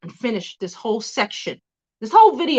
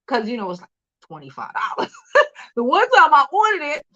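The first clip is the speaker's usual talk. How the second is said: usual speaking voice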